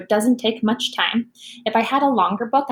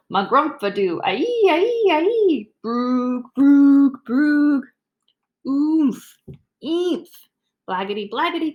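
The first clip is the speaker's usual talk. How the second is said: silly nonsense sounds; 4 semitones higher than usual. Acted. Teacher performs the book's silly sounds for the kids. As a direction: spit out each nonsense word with gusto. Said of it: "makes silly sounds like".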